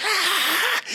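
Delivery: high, thin imitated crowd cheer; crowd going wild. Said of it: "and the crowd goes".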